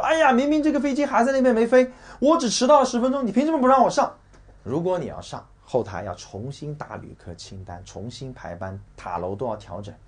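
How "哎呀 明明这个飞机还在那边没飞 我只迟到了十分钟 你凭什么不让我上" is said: high, loud outburst of a passenger left behind; indignant complaint. Acted. Late passenger arguing with airline staff, loud indignant whine. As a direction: wail loudly in protest, pitched way up. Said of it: "所以为什么说".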